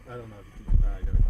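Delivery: real frantic annoyance; loud flustered complaint. Real, not acted. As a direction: struggle against the swarm. Swarmed by bugs outdoors.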